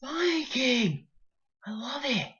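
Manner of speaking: put-on squealing high, gushing drunk girl; excited drunk girls. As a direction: squeal it like a giddy drunk partygoer. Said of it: "and they're like".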